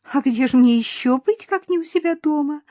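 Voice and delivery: high, sugary lisping coo; cooing baby talk. A narrator's reading. Mother-in-law to unwelcome daughter-in-law, sugary cooing. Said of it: "просюсюкала свекровь".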